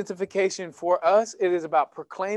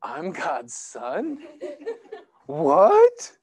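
slow, laughing disbelief, then a much higher yelp on What; mock shocked Jesus; 8 semitones higher, much slower than usual. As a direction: play a baffled, hammed-up double take. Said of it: "Was Jesus at that moment like".

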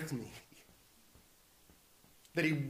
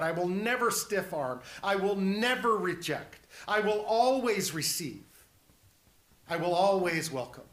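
raised, forceful beat on "never" and "always"; emphatic promise; 4 semitones higher, 5 dB louder than usual. Acted. Preacher voicing Jesus's promise to the congregation, emphatic. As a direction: hit each "never" and "always" hard.

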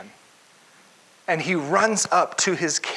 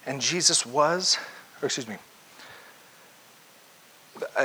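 usual speaking voice

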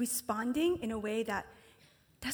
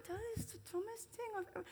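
trailing mutter; 7 semitones higher, 12 dB quieter, much faster than usual. Retold or imitated. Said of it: "you're like".

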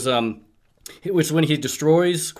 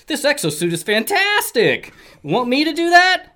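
excited; 13 semitones higher, 4 dB louder than usual. Acted. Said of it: "and says".

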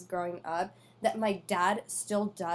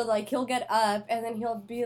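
usual speaking voice

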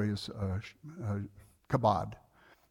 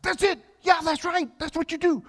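high excited praise, a bit louder; gleeful cheer; 5 dB louder than usual. Retold or imitated. Friend cheers him on gleefully.

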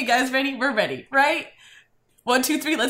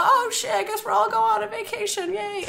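high sarcastic chirp; mock cheerful; 11 semitones higher than usual. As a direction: fake excitement about a road trip. Hero mock cheerful in retelling.

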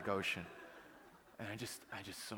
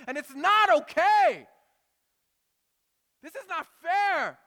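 screamed, much higher and louder, slowing at the end; angry outburst at God; 18 dB louder than usual. Retold or imitated. Preacher reliving his furious prayer at the ship's stern. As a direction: rage at God as if it's happening now. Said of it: "I was angry with God".

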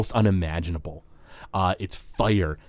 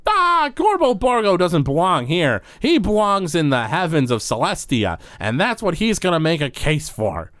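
indignant Gorbo Borgo; 7 semitones higher than usual. Acted. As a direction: plead your own case in a big high voice. Game master plays Gorbo Borgo.

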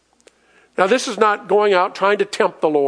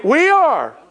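preacher's call; 6 semitones higher, much slower than usual. Acted. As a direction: shout the answer to the church. Preacher calls and answers himself.

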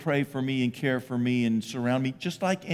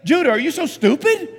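high, loud, exasperated scolding; hammed-up angry dad shouting at a toddler; mock scolding father; 13 semitones higher, 6 dB louder than usual. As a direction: berate a toddler in mock outrage. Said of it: "I don't pick him up and say".

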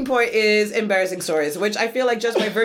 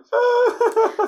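laughter; 4 dB louder than usual. Real, not acted. Laughing at own story.

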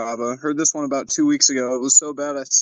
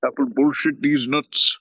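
put-on Indian accent. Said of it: "Indian accent".

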